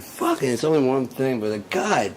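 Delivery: loud high-pitched angry pleading; furious outburst. Real, not acted. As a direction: rage at the memory. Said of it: "Still mad about that".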